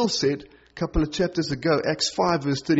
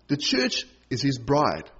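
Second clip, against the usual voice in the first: ordinary talk, nothing marked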